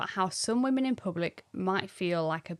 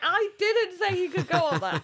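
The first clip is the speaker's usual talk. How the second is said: retold shout; 9 semitones higher, 5 dB louder than usual. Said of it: "she was shouting at the coach".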